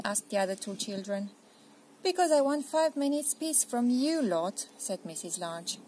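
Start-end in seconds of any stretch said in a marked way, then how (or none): 2.0-4.7 louder, higher, fed-up mum; tired mother demanding a break; exasperated mother. Acted. Mum tells the children she wants peace, exasperated.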